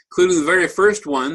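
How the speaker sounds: normal talking voice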